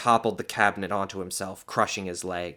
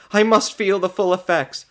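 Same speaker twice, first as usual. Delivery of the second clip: high, loud insistence; urgent demand; 11 semitones higher, 9 dB louder than usual. A narrator's reading. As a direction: press him hungrily. Woman begging doctor for blood.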